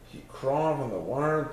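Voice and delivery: ordinary delivery, nothing marked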